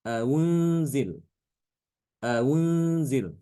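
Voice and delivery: aunzil with the second hamza softened toward w; tashil reading. Shown as a demonstration. Teacher modelling the softened reading aunzil. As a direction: ease the hamza of aunzil into a glide. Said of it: "Aunzila dibacanya".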